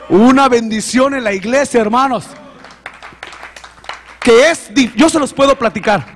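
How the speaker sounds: high, loud, fervent testimony; rising, loud pulpit voice; preaching at full force. Acted. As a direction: preach it out to the congregation. Preaching to the church.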